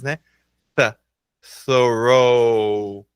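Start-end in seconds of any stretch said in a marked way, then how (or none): 1.6-3.1 "Thorough" begun with tongue between teeth; TH demonstration. Shown as a demonstration. Teacher showing the TH, "Thorough" said slowly and carefully. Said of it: "começa com a língua entre os dentes".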